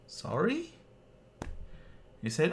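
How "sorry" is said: confused. Retold or imitated. Confused reply.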